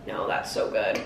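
whispered with mouth full. Real, not acted.

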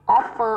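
"offer" slowly, with stress on "of"; first-syllable stress. Shown as a demonstration. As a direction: hit the "of" of "offer", let the end fall. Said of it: "âm một".

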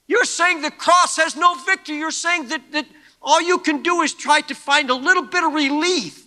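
high, loud revival preaching; preaching at full force. Acted. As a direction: press the crowd hard from the pulpit.

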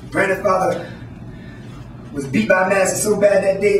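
normal talking voice

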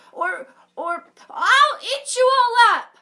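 high, loud threat with a stumble; fierce bluffing bunny. Acted. Dot bluffing to scare the bear, loud.

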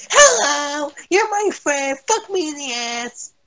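high, dopey mock voice; mocking the dim teammate, in a high silly voice. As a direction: imitate a clueless teammate. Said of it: "Just stares at the fucking screen saying".

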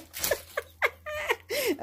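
giggling high laugh. Real, not acted. Laughs after dipping.

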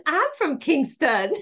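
high, drawn-out delighted exclamation; excited student. Retold or imitated. Student finds her teacher shares her hometown, excitedly. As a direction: squeal it slowly, thrilled at the coincidence. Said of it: "She goes".

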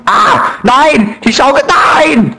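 high, hammed-up wailing no; dramatic mock grief over swing; mock anguished cry. Mourning destroyed swing in game.

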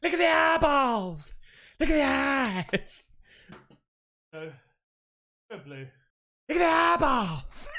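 thrilled at a gift; real delighted shout; excited. Real, not acted.

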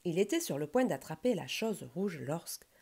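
ordinary delivery

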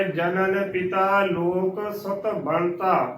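slow, careful verse line ending on two-syllable ਬਣਤਾ; measured scripture demo, no vowel after n in ਬਣਤਾ; model recitation. Shown as a demonstration. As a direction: recite slowly, ਬਣਤਾ as ban-ta. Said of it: "ਬਣਤਾ ਉਚਾਰਨ ਕਰਨਾ ਹੈ".

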